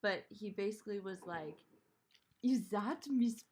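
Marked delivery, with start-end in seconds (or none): 2.4-3.4 put-on aborted German accent, higher and louder; badly faked German accent, drawn out slowly; attempted German accent. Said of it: "a German accent".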